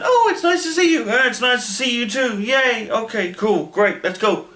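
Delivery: gushing reunion. Acted. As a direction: squeal the reunion like an overexcited kid. Padme and Panaka's reunion acted out.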